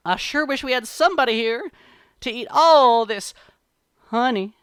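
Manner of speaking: high, loud, teasing lament; showy high-pitched wishing; put-on party voice. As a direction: dangle the bait for the bear to overhear. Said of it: "and he says".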